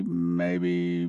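maybe dropped low and soft, teasing; a low, hushed maybe; mock doubt. Preacher teasing doubters, low hushed maybe. Mock wavering over hidden swirl.

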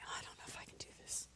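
faint, quick whisper; barely audible doubting whisper; whispered self-doubt. Acted. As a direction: whisper it like a creeping thought. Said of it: "I start accepting that lie".

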